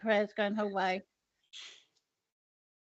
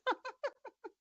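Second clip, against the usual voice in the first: high laugh; 5 dB quieter than usual. Real, not acted. Laughs at matching tops.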